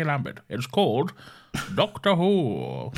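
mock vintage continuity announcer; hammed-up BBC programme introduction; old BBC announcer. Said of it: "I can only imagine that's how it went".

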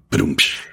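vocal imitation of a drum sting; punchline drum hit done by mouth; spoken rimshot. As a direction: mouth a comedy ba-dum-tss. Drum sting after his own joke.

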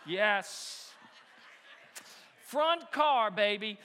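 loud, gleeful whoop; cheering his own win; triumphant cheer. Real, not acted. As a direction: celebrate the front car out loud. Excited dad at front car.

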